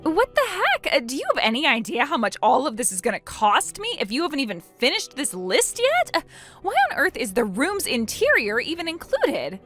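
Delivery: high-pitched, exasperated protest; outraged questions leaping upward; incredulous outrage. Acted. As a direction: object in appalled disbelief. Opal protests the growing bill.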